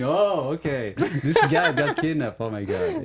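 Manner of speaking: laughing mock-sympathy, voice pitched high; amused imitation of people shrugging off a kidnapping; mocking laughter. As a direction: giggle through it, mocking the reaction. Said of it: "And everyone think it's funny".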